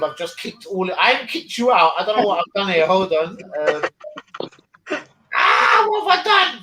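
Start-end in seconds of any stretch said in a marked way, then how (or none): 5.3-6.6 sudden high shout of alarm; panicked yelp. Real, not acted. Streamer realises he kicked everyone, alarmed yelp.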